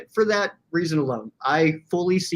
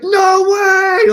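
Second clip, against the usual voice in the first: much higher, loud outburst of disbelief; shocked shout; 12 dB louder than usual. Retold or imitated. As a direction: yell like the trade just broke. Shocked by trade news.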